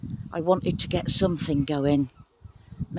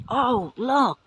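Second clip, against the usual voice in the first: excited; 5 semitones higher, 4 dB louder than usual. Real, not acted. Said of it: "I'm getting excited again".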